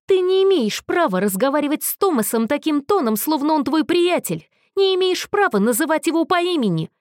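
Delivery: громче и напористее, с рыком; прорычи, защищая Томаса; прорычал. A narrator's reading. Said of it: "прорычал Мэтью". (прорычал, обвиняя Алистера)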